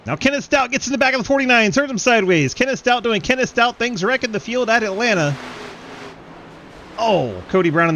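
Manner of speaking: high, loud race commentary at full pitch; fired-up play-by-play yell; excited wreck call. Real, not acted. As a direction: shout the crash as it happens.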